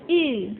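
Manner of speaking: "U" said as an i sound with rounded, pushed-out lips; Mandarin ü. Shown as a demonstration. Teacher showing pupils the rounded ü sound, carefully. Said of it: "mulutnya maju... tapi bacanya I".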